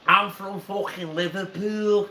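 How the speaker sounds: mock Scouse declaration; Liverpool accent. Shown as a demonstration. Mate finishing the dare, imitated Scouse accent. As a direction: proclaim it in put-on Liverpool voice. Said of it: "Go on, do a Liverpool accent".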